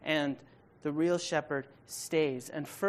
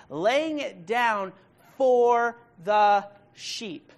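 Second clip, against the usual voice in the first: slow and loud, "for" drawn out and set apart; stress on FOR; 4 semitones higher, 5 dB louder, much slower than usual. Acted. Preacher voicing the shepherd's sacrifice, stress on FOR. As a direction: punch "for", spacing out the words after it. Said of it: "the emphasis is on the word for".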